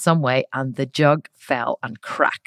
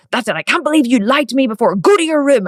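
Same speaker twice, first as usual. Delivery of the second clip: higher, louder furious order; angry dad; 8 semitones higher, 6 dB louder than usual. Retold or imitated. Dad ordering his daughter to her room, angry shout. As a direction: send her off in a fury. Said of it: "he was like".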